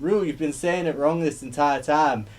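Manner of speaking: mock accent building to a shouted Hey Claire; imitating a terrible accent. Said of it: "That accent was terrible".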